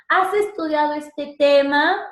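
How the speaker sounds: "¿Has estudiado este tema?" slow, loud, climbing to TEMA; rising intonation. Shown as a demonstration. Teacher demonstrating a question, rising tune. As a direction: let the question climb steadily to its end. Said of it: "Mira cómo va subiendo".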